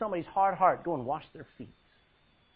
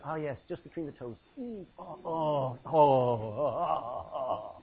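hammed-up pleasure at a foot rub; mock indulgent moans; 4 semitones lower, slower than usual. Mocking selfish enjoyment.

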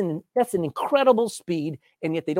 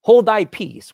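loud, firm command; Jesus silencing the demon; rebuke; 3 semitones higher, 9 dB louder, slower than usual. Acted. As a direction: rebuke the spirit sharply. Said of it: "Jesus rebuked him".

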